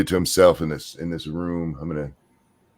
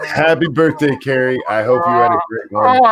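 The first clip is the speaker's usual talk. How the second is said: cheerful; 6 semitones higher, 3 dB louder than usual. Real, not acted. Birthday wish after song.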